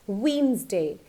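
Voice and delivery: WEDNESDAY as "WEENZ-day", d silent; correct pronunciation. Shown as a demonstration. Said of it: "but it is not correct. It's".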